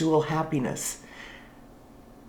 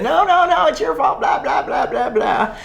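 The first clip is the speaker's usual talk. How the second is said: mock defensive protest; 8 semitones higher than usual. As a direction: play the defensive reply for laughs. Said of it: "could have gotten defensive and said".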